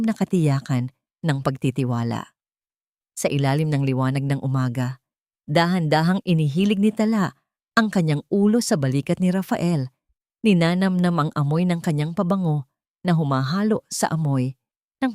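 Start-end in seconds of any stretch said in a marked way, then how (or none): none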